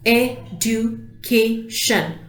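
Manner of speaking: "Education" slowly as e, du, ca, tion; four separate beats on "Education"; split into syllables. Shown as a demonstration. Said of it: "four syllables".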